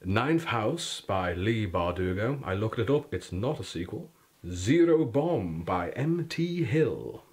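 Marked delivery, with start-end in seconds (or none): 4.5-7.2 dramatic announcement. Acted. Comic title reading.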